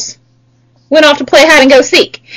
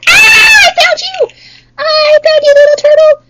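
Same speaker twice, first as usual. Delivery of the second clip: high, delighted cry of finding; excited discovery; 13 semitones higher than usual. Pretend. Teacher playing the seeker, finding a turtle: high delighted cry. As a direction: squeal at the found turtle. Turtle found in hide and seek.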